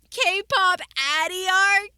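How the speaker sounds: loud, slow, thrilled yell; excited shout. Real, not acted. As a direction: shout it out in delight. Excited at the K-pop idea.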